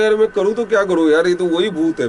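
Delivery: high, loud, fast panic; a boy sure he has seen a ghost, panicking fast; panicked. Retold or imitated. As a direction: blurt it out as the fear spikes.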